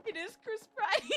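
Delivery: high and laughing through words. Real, not acted. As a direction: crack up mid-joke. Joking while laughing.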